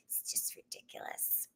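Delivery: very soft whisper; awed whisper. Real, not acted. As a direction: breathe it in awe.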